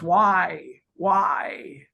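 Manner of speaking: wounded creature calling out as it flees; mock crying out. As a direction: cry out "why" in light make-believe hurt. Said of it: "this Bigfoot starts crying".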